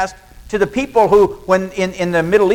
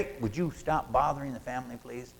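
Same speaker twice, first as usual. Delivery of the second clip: much softer, low, fast hush; hushed usher; 7 semitones lower, 15 dB quieter than usual. Acted. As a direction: quietly shoo a stranger away. Usher hushing the stranger.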